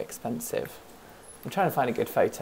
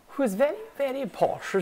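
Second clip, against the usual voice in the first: very posh lady, high and a little louder; Queenie Deerheart voice; 11 semitones higher, 4 dB louder than usual. Shown as a demonstration. Introducing her deer character to the stream, high posh voice. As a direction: lift the pitch way up into a plummy posh lady. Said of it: "She talks like that".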